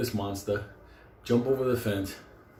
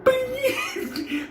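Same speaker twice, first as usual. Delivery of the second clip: very high-pitched piercing "bing"; imitated buckle snap. Storyteller imitates the buckle slipping, sudden high squeal. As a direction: squeal out the sound of metal giving way. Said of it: "the fucking worst sound we ever heard".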